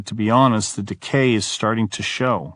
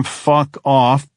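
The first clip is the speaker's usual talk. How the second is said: a loud, higher shouted curse; retold scream; 5 semitones higher, 5 dB louder than usual. At someone banging on the door, shouted. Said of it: "until I just scream".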